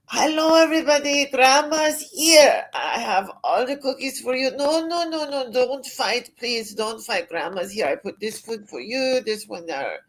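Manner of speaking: grandma calling cats in German accent. Shown as a demonstration. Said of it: "almost like a German accent when I'm feeding my outdoor cats".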